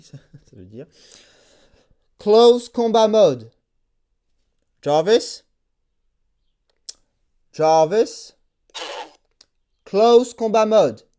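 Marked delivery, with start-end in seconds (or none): 2.2-11.1 slow, louder, over-enunciated English; careful English command. Real, not acted. Struggling with bad English to close combat mode, slow and careful. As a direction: repeat the command slowly, trying to be understood.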